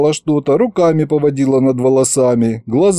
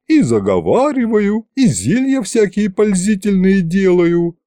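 put-on old witch voice; 7 semitones higher than usual. Witch boasts of her craft.

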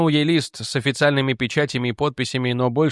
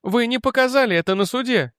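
crying out; 11 semitones higher than usual. Acted. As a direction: cry out in accusation. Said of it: "она выкрикнула". (выкрикнуть с упрёком)

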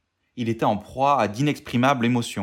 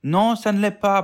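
put-on high, stubborn little boy; much higher, louder whiny protest; protesting child; 9 semitones higher, 4 dB louder than usual. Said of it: "protestait le petit garçon".

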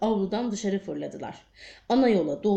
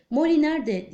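higher-pitched, moderately raised narrated cry; 6 semitones higher, 4 dB louder than usual. A narrator's reading. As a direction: call it out, pitch lifted, without a full shout. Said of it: "diye haykırdı". (tiz, hafifçe yükseltilmiş bir haykırış)

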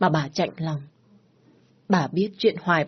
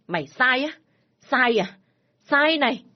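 mocking fury rising to a high yell; angry shout; 7 semitones higher, 4 dB louder than usual. Father mocking his daughter's apology, shouting. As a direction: hurl it back at her.

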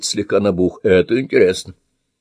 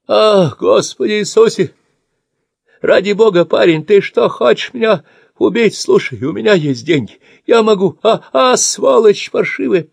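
terrified begging; 12 semitones higher, 4 dB louder than usual. Acted. Old man terrified, begging.

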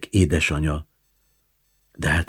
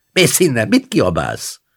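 higher, louder snapping back; scolding; 9 semitones higher, 4 dB louder than usual. A narrator's reading. Grandma scolds the shouting neighbour, sharp rebuke. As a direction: tell off the noisy woman.

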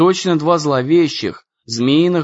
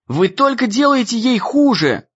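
shout; 8 semitones higher than usual. A narrator's reading. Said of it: "крикнул он".

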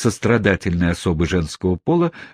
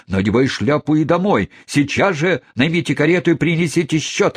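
stern scolding; 6 semitones higher than usual. A narrator's reading. Wardle scolds his sister.

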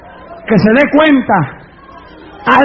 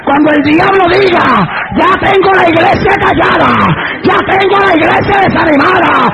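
full-force preaching; 6 semitones higher than usual. Acted. Preacher defying the devil who wants a silent church, full-force preaching. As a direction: build it toward a shout.

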